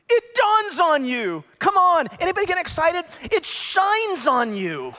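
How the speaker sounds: high, loud preaching build; full-force preaching. Acted. Pastor rousing the congregation, full-force preaching. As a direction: preach it out to the crowd. Preaching climax on being changed.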